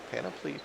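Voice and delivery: Panoply said PAN-o-plee, rising, unsure; testing how to say Panoply; trying out panoply. Shown as a demonstration. Streamer unsure how to say Panoply. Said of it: "I'm not actually sure I pronounced that word".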